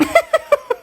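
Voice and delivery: high squealing laugh; high burst of laughter. Real, not acted. Owner laughing at the toy the dog wrecked. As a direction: let out a high delighted laugh.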